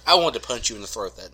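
usual speaking voice

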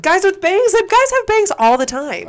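high, loud incredulous protest; loud disbelief. Real, not acted. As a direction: pitch it way up, insisting. Incredulous at partner's doubt.

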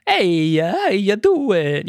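slow, drawn-out Brooklyn Italian-American greeting; light Brooklyn accent, Mario style; Brooklyn plumber accent. Retold or imitated. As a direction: lean into a Brooklyn plumber's "how you doing". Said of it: "sounds like a plumber from Brooklyn".

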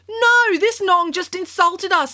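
put-on high, outraged young girl. A narrator's reading. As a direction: protest like an insulted kid. Kat outraged at judge.

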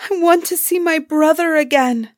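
high, tearful storybook plea; weeping. A narrator's reading. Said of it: "she wept".

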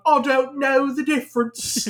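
put-on high, slow parody English accent; hammed-up bad London accent; mocking London accent. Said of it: "making fun of the accent".

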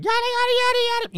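very high squealed nonsense; mocking angel speak, squealed very high; mock angel gibberish.

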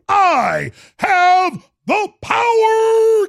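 shrieking. Retold or imitated. Catchphrase, shrieked high.

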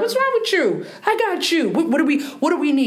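high-pitched imitation of a mother's challenge; Somali mom. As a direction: mimic mom's indignant questions in a high voice. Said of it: "as a Somali mom".

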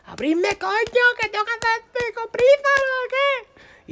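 very high, drawn-out mock yell; shouting to be let out. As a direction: holler through the locked door. Locked in, banging on door.

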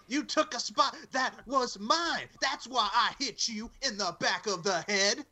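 hammed-up high promo whine; mock wrestling promo. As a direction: imitate an aggrieved wrestler, dragging every word. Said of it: "That's what he said".

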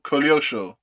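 usual speaking voice

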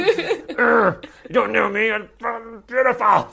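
high, loud hammed-up outburst breaking into laughter; mock tantrum. Man retells wanting to flee on day six, mock tantrum. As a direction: send up your own meltdown.

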